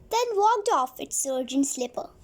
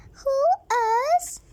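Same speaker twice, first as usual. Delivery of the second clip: higher, louder "who us?" protest; mock surprise; 9 semitones higher, 4 dB louder than usual. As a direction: cry out innocent surprise. Boys protesting innocence.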